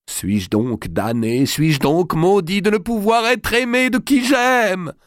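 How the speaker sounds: slow, rising to a high anguished cry; drawn-out cry of despair; despairing lament. Acted. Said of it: "Il prononça, comme pour lui-même". (lamentation désespérée, lente puis aiguë)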